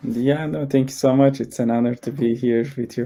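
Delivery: ordinary talk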